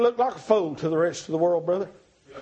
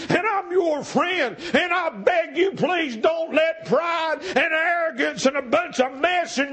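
full-force preaching; 7 semitones higher than usual. Acted. Preacher pleading with the congregation to board the boat, shouting. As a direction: cry it out, begging them not to let pride stop them.

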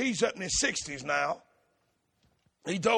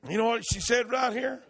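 usual speaking voice

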